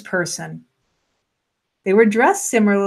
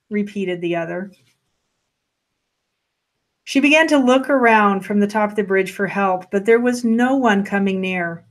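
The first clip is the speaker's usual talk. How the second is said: usual speaking voice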